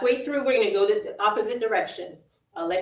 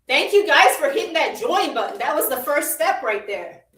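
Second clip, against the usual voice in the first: upbeat workout cueing, pushing the pace; aerobics instructor; 4 semitones higher than usual. Acted. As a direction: cheer them on through the march. Leads the warm up.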